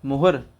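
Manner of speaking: मुहुर ending on a clear r; careful pronunciation. Shown as a demonstration. Teacher modelling मुहुर for the class. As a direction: model मुहुर with the final र sounded. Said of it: "इस तरह से पढ़ेंगे".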